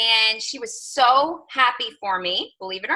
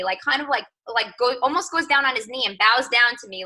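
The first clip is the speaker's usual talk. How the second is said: ordinary talk, nothing marked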